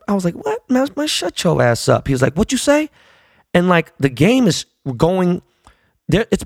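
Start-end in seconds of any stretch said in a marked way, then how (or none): 0.3-2.1 high-pitched mock indignation; retold heckle. Fan telling a player to shut up, indignant. Retelling courtside argument with player. 2.4-2.9 high, rising challenge; retold challenge. Player confronting a heckler courtside, challenging.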